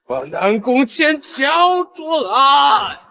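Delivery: slow commanding shout. Acted. Villain demands the captive.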